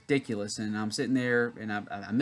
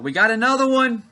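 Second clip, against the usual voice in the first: much higher, louder shout across the hall; retold yell; 11 semitones higher, 9 dB louder than usual. Lady waiting for him shouts to staff, loud call. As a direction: call it out like a staffer announcing a catch. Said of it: "she yells out".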